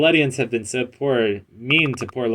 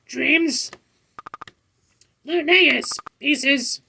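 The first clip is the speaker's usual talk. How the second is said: pet voice; much slower than usual. Acted. Voicing pet character.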